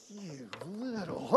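slow, restrained fury; mock seething under breath. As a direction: bite back the insult. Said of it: "everything in me was like".

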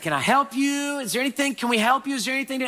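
high, sweet, eager offer; very nice voice. Retold or imitated. Pastor eagerly asking the old lady if she needs help, high and sweet. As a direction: sound as kind as you possibly can. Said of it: "It's a very nice voice".

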